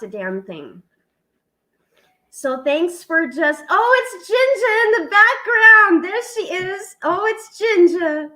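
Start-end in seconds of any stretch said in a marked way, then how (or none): 3.7-8.3 high, loud burst of delight; excited greeting. Real, not acted. Host spotting Ginger in the background, excited. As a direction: squeal out the news as your friend appears. Spots her friend joining the stream.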